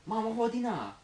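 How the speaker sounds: Chinese accent. Shown as a demonstration. Accent game with friends, mock Chinese accent. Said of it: "do Chinese again?".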